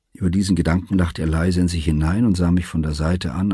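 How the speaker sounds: usual speaking voice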